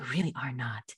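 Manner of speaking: whispered reassurance, low and soft. Real, not acted. Reassuring worried families, whispered softly. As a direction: breathe it gently to the listener. Reassuring callers they aren't alone.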